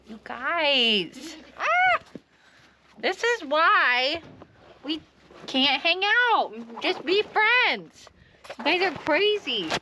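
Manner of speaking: high, slow playful goat-talk; high pet voice. Real, not acted. Goats climbing all over her.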